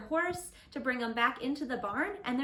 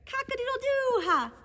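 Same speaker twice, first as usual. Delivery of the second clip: laughing rooster; 8 semitones higher, 4 dB louder than usual. Acted.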